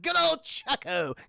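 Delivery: high, slow, gleeful villain voice; taunting villain. Acted. The Joker chasing Charlie, gleefully taunting. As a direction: tease it out slowly.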